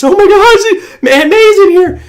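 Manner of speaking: high loud spooky panic; hammed-up terror at a seance; mock freakout. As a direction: shriek it like the ghost just arrived. Ouija planchette moves, friends freak.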